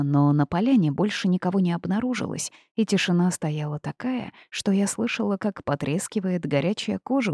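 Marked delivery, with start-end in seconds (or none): none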